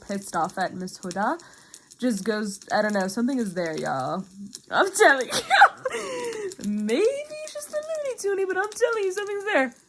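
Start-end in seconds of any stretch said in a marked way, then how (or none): 4.7-9.7 high-pitched, laughing squeal; giddy insistence. Real, not acted. Fan insisting to viewers on a hidden romance, giddy squeal.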